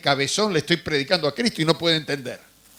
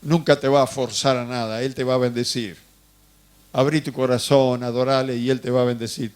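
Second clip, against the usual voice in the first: lower, calm reassuring voice; gentle pastor; 7 semitones lower than usual. Retold or imitated. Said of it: "apareció un pastor que me dijo". (pastor sereno y tranquilizador, voz más grave)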